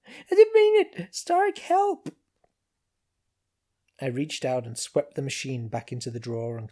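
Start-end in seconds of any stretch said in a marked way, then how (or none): 0.1-2.1 very high, loud panicked squeak; panicked squeal. A narrator's reading. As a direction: squeal for help in terror. Machine panics, shrieks.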